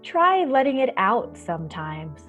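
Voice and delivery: ordinary delivery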